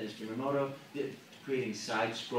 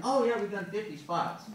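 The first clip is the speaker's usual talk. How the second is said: breezy client; 9 semitones higher, 3 dB louder than usual. Acted. Acted client answering breezily.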